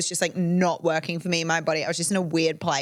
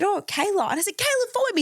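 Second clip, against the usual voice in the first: excited squeal; 12 semitones higher than usual. Retold or imitated. Excited phone call with brother.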